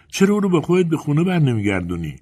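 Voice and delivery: put-on high, questioning child-like voice; little girl. A narrator's reading. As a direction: ask with a child's innocent insistence. Little girl urges the woman.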